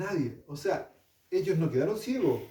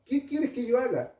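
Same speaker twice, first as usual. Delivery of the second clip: pitched higher, begging; higher pleading plea; 8 semitones higher than usual. Acted. Saulo begs the Lord for orders, higher pleading. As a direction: plead it up high, desperate. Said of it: "temblando y temoroso".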